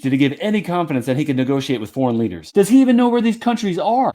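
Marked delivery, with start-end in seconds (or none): 2.5-4.1 incredulous sarcasm. Real, not acted. Mocking the president.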